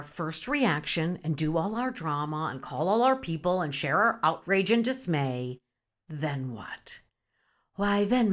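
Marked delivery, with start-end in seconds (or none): 6.1-7.0 breathy whispered question. Acted. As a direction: lean in and whisper it like a secret. Hushed aside to viewers.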